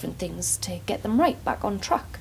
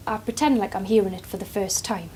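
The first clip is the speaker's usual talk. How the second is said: ordinary talk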